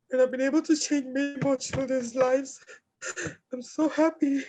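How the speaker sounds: high, tearful voice with halting pauses; real crying, pitch pushed up as words come out; overcome with emotion. Real, not acted. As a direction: struggle through tears to speak. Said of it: "I'm so emotional right now".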